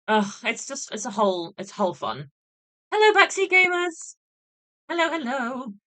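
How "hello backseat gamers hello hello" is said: much higher, louder sing-song hello; squeaky stream greeting. Real, not acted. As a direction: greet the chat in a high squeal.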